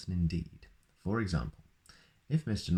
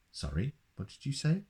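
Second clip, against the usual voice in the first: mock puzzled; 8 semitones higher than usual. Baker's wife feigns deafness.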